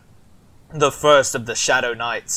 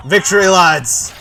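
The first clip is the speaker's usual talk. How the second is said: laughing shout, higher and louder; victory shout; 6 semitones higher, 10 dB louder than usual. Acted. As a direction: cheer the men with a laugh. Celebrating won battle with troops.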